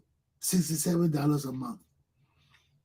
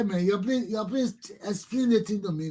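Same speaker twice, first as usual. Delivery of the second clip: ordinary talk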